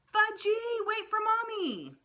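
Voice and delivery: very high, loud calling mother; hollering. A narrator's reading. Mother hollering after her running son, high and loud. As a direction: call after the running child.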